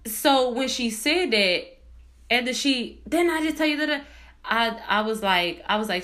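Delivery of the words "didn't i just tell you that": higher, mock-irate comeback; retold irate snapping. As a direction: imitate her indignant challenge. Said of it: "how fast she went from zero to a hundred".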